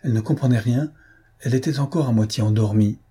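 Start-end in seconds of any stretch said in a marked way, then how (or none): none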